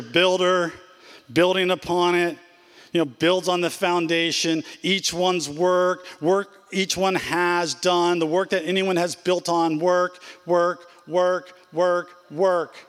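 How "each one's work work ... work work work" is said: each work slow and leaned on, repeated; stress on work. Shown as a demonstration. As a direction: hammer the word work, slowly, over and over. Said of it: "notice the verbiage here".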